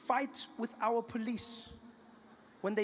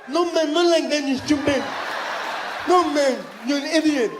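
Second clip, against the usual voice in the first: loud, high exasperated deaf-man imitation; mock-outraged shout in a deaf voice; partially deaf fan; 8 semitones higher, 11 dB louder than usual. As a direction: scold loudly with blurred consonants. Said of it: "he was partially deaf".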